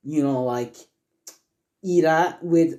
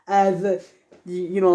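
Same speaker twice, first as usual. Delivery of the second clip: ordinary delivery, nothing marked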